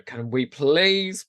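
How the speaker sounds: ordinary talk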